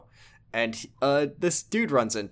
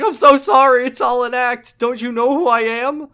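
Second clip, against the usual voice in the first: high, loud apologetic squeal; high frantic greaser; 11 semitones higher, 9 dB louder than usual. Retold or imitated. Greaser apologises to Kiryu in high frantic voice. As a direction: plead it in a cartoonish squeak. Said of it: "He's like".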